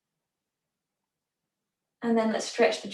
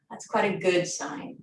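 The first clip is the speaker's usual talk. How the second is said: usual speaking voice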